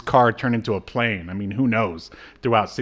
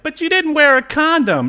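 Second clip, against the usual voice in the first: much higher, louder mock voice; mock outraged partner; 6 dB louder than usual. The other party in the mock scene, high squeaky protest.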